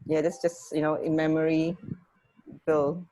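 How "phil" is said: usual speaking voice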